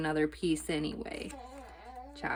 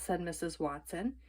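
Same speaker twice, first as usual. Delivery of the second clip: ordinary delivery, nothing marked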